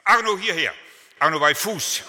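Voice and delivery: loud, high call to a dog; commanding a poodle to come, loud and high. Retold or imitated. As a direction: shout the dog's name across the yard, loud and high. Someone calling a dog.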